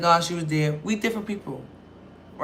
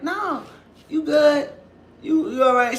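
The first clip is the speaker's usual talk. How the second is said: high reassuring; 8 semitones higher, 3 dB louder, slower than usual. Retold or imitated. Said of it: "my cousin's like".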